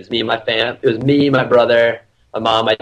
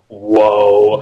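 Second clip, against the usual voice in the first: low, loud drawn-out whoa; mock awe, pitched down; imitated stunned whoa; 4 semitones lower, 5 dB louder than usual. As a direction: play a friend taken aback. Acting out Dan's stunned reaction.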